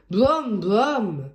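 brum brum, chugging tractor sound; playful tractor noise; tractor engine noise. Acted. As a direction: make the tractor rumble.

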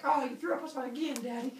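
put-on high kid voices protesting; complaining children. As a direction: whine up at Daddy from the pit. Earlier children complain from pit.

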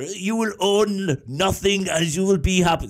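a bad German villain accent, drawn out slowly; hammy German accent of a Bond villain; terrible German accent. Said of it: "that's a terrible accent".

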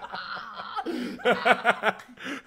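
long, loud high-pitched laugh; high, loud belly laugh; helpless laughter. Real, not acted. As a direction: crack up loudly and keep it going. Cracking up at shiny form joke.

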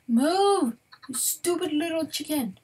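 annoyed shout; frustrated yell. Real, not acted. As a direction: bark at a stubborn animal. Frustrated at chicken.

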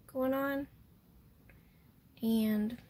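ordinary talk

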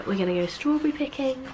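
ordinary talk

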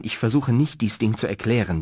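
plain reading voice

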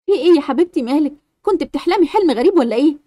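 put-on calm the frightened child down; comforting mother.

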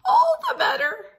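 wolf about to pounce on Red, squeaky strained voice; very high, loud granny wolf. Acted. Wolf as grandma.

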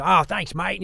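a loud, high Aussie voice for a Brit actor; hammed-up Australian accent; bad Aussie accent. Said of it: "That was an Aussie accent".

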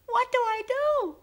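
very high, slow, panicky little alien voice; crying creature. Acted. Nervous creature at the ship controls crying out, very high and slow. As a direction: wail it like a lost frightened creature. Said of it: "the creature cried".

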